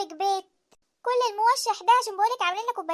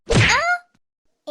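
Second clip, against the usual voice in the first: صرخة من القلم; 7 semitones higher, 7 dB louder than usual. Acted.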